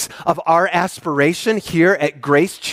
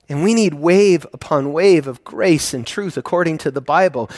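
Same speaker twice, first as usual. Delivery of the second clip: ordinary delivery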